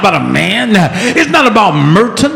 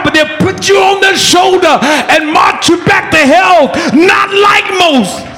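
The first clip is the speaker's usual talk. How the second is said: preaching at full force; 6 semitones higher than usual. Real, not acted. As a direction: build and hammer it to the congregation.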